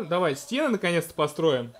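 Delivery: usual speaking voice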